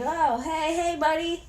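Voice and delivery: a careful, high-voiced imitation of wary classmates; tentative mock greeting. Classmates greeting Deku as he walks in, tentative.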